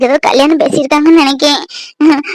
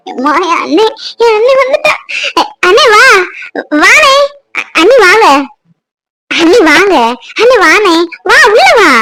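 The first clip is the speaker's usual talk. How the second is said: joyful welcome; 4 semitones higher than usual. Acted. Joyful welcome of long-absent brother.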